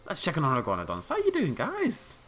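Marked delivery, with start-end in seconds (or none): 1.1-2.2 way up high and slow, cooing at them; high sweet greeting. Pretend. Greeting the iguanodons in a high slow coo. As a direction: coo it like talking to pets.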